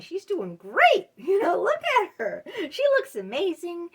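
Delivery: high, loud gushing praise from an imagined onlooker; mock admiring onlooker. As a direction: gush over her like an impressed stranger. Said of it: "look like, Oh, wow, that woman got it all together".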